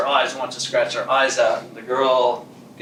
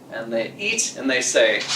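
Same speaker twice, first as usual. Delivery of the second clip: ordinary delivery, nothing marked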